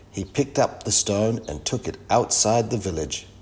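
ordinary delivery

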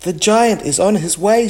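high, quick, loud warning; storybook cry of warning. A narrator's reading. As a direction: raise the alarm in a hurry. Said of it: "she cried".

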